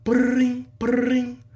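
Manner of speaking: high mock ring-ring; phone ring. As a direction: sing out the ringtone. Imitating phone ringing.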